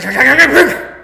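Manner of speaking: high, loud rattling clacks; imitated engine clacking. Engine gives out at the crossroad, imitated loud high clacking. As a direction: mimic an engine seizing up noisily. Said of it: "the engine went clack".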